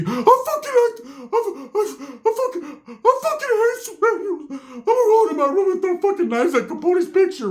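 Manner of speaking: very high whiny half-sobbing voice; crybaby Ricky. Retold or imitated. Beaten Ricky whines to his cousin, high crying voice. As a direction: whimper it high like a kid crying.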